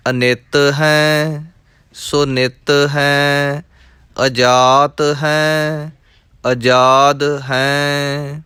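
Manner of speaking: measured, rhythmic chanting of verses; scripture recitation. Acted. Teacher reciting scripture for students, rhythmic and measured. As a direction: recite each line as sacred text, evenly.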